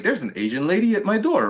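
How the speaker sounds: high-pitched baffled surprise; surprised. Retold or imitated. Retelling who appeared at his door, surprised. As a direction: retell it as a startled discovery.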